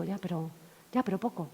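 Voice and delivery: soft, trailing reluctance; hesitant murmur. Retold or imitated. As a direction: say it quietly, dreading it. (murmullo reacio y bajo)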